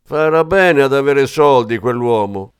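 loud high screeching hag; old woman screeching. Acted. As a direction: screech it through the door. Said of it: "berciò la vecchia". (vecchia che sbraita acuta e forte)

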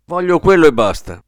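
nervous, insistent. A narrator's reading. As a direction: put your foot down, on edge. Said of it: "insiste il cliente nervoso".

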